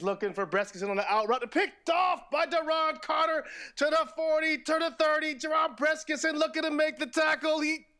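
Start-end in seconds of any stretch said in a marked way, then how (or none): 1.5-7.9 high, shouted play-by-play call; mock play-by-play. Host mock-calling the play in a shouted announcer voice. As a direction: call the interception like an excited announcer. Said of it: "here we go".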